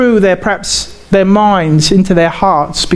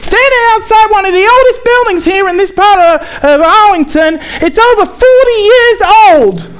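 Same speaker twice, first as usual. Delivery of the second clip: higher-pitched, hammed-up American newscaster accent; bad American accent; 13 semitones higher, 4 dB louder than usual. Imitating a US reporter on the news for the congregation, bad American accent. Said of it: "that's my very bad American accent".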